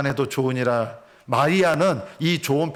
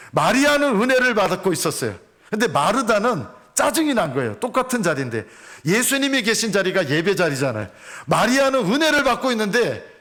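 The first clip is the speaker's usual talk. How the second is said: impassioned preaching; 6 semitones higher than usual. Acted. Pastor preaching fervently to the congregation. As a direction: drive the point home to the congregation.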